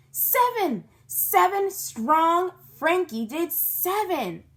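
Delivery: high, loud, slowed cheer; excited kid shouting the count; shouted. A narrator's reading. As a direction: yell it out proudly. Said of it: "shouted New Thelma".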